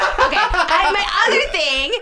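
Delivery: loud, high, drawn-out exclamation; high-pitched exasperated holler; worked-up outburst. Real, not acted. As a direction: belt it out slowly, stretching each word. Worked up, bursts into next grievance.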